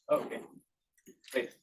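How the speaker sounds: ordinary talk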